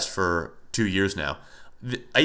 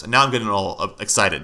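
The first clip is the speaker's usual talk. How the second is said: ordinary talk, nothing marked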